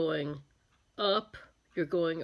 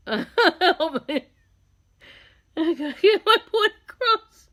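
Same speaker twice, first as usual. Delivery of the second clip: high, loud, words breaking up in laughter; helpless real laughter; 8 semitones higher, 7 dB louder than usual. Real, not acted. Makeup artist can't stop repeating her joke, helpless tearful laughter. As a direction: plead through an uncontrollable laughing fit. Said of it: "I'm crying laughing".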